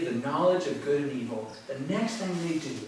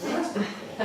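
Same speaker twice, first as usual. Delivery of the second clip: amused approval; 7 semitones higher, much faster than usual. Retold or imitated. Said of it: "I was like".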